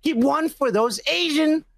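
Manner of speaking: normal talking voice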